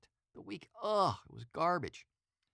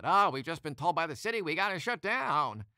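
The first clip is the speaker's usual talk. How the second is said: put-on old-time newsreel panic, higher and louder; breathless wartime announcer alarm; panicked 1940s style voice; 7 semitones higher, 8 dB louder than usual. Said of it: "they said it in a panic nineteen forty style voice".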